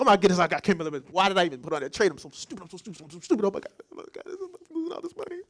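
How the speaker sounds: panicked self-scolding that collapses to a breathless whisper; retold freak-out. Trader berating himself over lost money, frantic and breathy. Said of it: "I'm freaking out".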